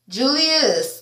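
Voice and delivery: ordinary delivery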